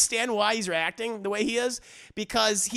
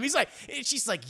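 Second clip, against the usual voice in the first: usual speaking voice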